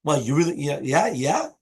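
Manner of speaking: last "yeah" lifts sharply in doubt; rhetorical questioning yeah. Shown as a demonstration. Teacher showing a yes that means no, rhetorical. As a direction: repeat "yeah" with rising, questioning tune. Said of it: "like, rhetorically".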